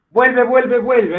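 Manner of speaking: calls out vuelve loud and high; loud recited refrain. Shown as a demonstration. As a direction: belts the repeated word like a refrain. Teacher reads a repeating verse example.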